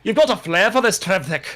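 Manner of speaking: hammed-up British accent with faltering starts; bad British accent. Reader voicing a character's compliment in a bad British accent. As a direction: put on a lively, badly faked British accent. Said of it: "I'm gonna stop with the British accents".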